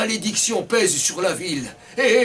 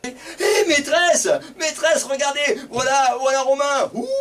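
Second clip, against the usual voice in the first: much higher, calling teacher; child pointing at Romain, higher; excited child; 9 semitones higher than usual. Acted.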